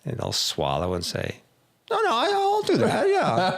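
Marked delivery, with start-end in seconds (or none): 1.9-3.6 squeaky-high nervous agreement, imitating a client; mock a client agreeing in a suddenly high, louder voice; voice going up high. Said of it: "Their voice goes up a half octave".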